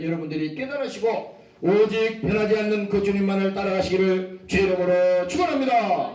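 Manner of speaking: loud proclaimed benediction; loud blessing. Real, not acted. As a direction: bless the congregation at full voice. Closing benediction to congregation. (회중에게 크게 선포하는 축원)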